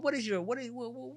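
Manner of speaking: ordinary talk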